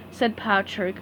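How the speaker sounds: ordinary delivery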